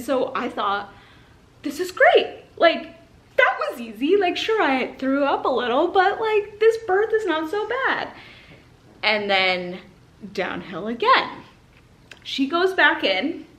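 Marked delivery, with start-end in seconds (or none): 1.6-8.1 high, louder giddy relief; elated. Retold or imitated. Mother thinking the birth is easy, elated. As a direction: gush with relief.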